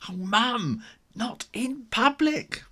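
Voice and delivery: high, slow mortified whine; embarrassed kitten. A narrator's reading. Ginger begging mum not to kiss him in public, high. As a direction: plead in embarrassment.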